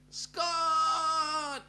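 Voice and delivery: a long, high, loud shout of the name "Scott!"; big drawn-out call. Pretend. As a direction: belt the name out like calling across a beach. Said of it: "Uh-oh, [name]'s doing it".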